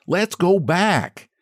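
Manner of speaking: high, slow whine from a little man; a bedraggled man begging to turn back; little man's high, slow plea to turn back; stifled plea. A narrator's reading. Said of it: "stifled and bedraggled".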